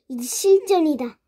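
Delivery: ordinary delivery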